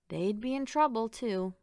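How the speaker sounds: ordinary delivery, nothing marked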